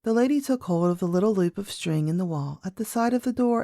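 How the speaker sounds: ordinary delivery, nothing marked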